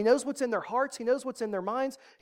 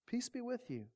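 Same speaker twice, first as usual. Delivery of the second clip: a much softer second peace be with you; hushed repeat; 3 semitones higher, 13 dB quieter than usual. Acted. Jesus calming his frightened friends, hushed and gentle. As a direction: let it fall to a hush. Jesus greets troubled disciples.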